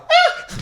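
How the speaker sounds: very high laughing fake shriek; mock scream. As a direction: yelp as if Freddy were behind you. Pretend fright at seeing Freddy.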